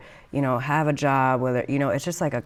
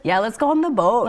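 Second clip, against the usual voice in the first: put-on Minnesota accent; 7 semitones higher, 4 dB louder than usual. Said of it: "a classic Minnesotan here".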